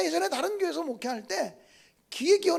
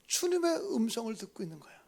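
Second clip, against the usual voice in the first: ordinary delivery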